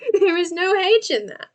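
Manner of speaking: high, laughing, emphatic H; laughing insistence. Real, not acted.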